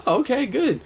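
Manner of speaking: a light, high surprised reply; surprised okay. Retold or imitated. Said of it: "And I'm like".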